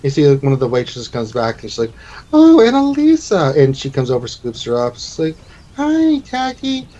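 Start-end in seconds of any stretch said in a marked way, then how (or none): 2.3-3.6 high, slow cooing exclamation; gushing high female-character voice; delighted waitress. Acted. Waitress exclaims on seeing child. 5.8-6.9 put-on high, drawn-out child voice; small child's slow greeting; little girl.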